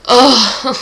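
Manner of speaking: loud, upset "ugh"; exasperated groan. Real, not acted. Reader upset by a character's death, groaning loudly. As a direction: let out a big groan of dismay.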